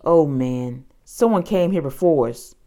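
ordinary delivery, nothing marked

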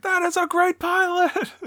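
high, drawn-out imitation of a cheer; mock shouting. Finn cheering the ace pilot, mock shout. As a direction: whoop it in a high voice, without real volume. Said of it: "Finn even shouting".

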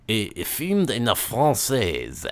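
lapse into hammy French; mock French; French accent. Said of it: "best French voice on for this".

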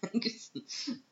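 bright high laugh; quick self-amused laugh. Real, not acted. Mom laughing at herself.

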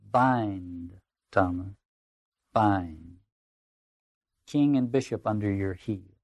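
stately incantatory verse, long pauses; seductive fourth tempter. Acted. Tempter urging Thomas towards martyrdom, measured declamation. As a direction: declaim a mounting temptation, dwelling on key words.

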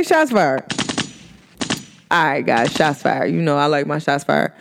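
usual speaking voice

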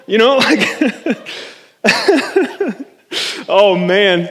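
helpless chuckle spilling into the words; real laughing. Real, not acted. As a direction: crack up mid-sentence. Cracks up at own joke.

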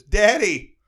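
cries out Daddy, high and loud, overjoyed; a son finally finding his father; joyful cry. Acted. Jesus reunited with his father, calls out overjoyed.

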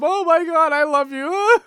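high, loud lovestruck exclamation; gushing mock lover. Acting out the pair looking through the window, mock gushing.

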